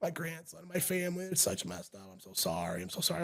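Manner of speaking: soft, high, frail mock crying; hushed, pleading, lightly tearful retelling; imitating tearful apology. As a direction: imitate a weeping old woman apologizing. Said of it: "she starts crying".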